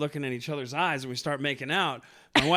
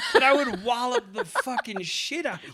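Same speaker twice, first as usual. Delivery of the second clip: half-laughing, higher and louder boast; laughing mock threat; 8 semitones higher, 5 dB louder than usual. Real, not acted. Husband teasing his wife, laughing, higher and louder. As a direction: chuckle through a playful threat.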